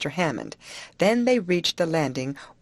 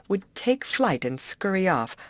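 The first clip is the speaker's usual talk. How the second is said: ordinary delivery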